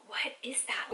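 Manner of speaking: whispered, wrinkling her nose; a whispered what is that, disgusted. Retold or imitated. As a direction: hush it like the smell offends you. Whispered reaction to the stink.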